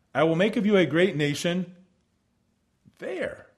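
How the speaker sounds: there lifted high and set apart after a pause; single out the word there; stress on there. Shown as a demonstration. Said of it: "But one word is added here".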